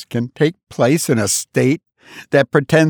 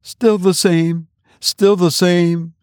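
weary repetition; 7 semitones higher, slower than usual. A narrator's reading. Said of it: "was the answer".

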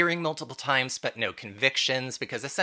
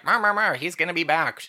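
mock scolding; 6 semitones higher, 3 dB louder than usual. Mocking Logan scolding Briscoe.

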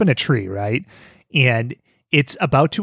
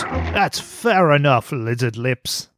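slow, drawn-out mock Australian accent; imitated cartoon Australian; Aussie impression; much slower than usual. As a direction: stretch it out in a bad Aussie accent. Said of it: "that true blue Aussie nature".

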